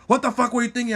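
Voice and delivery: high-pitched mock outrage; heated, high imitation of a rant; imitated yelling. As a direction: yell it like an angry driver. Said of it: "yelling that I fucked up his B M W".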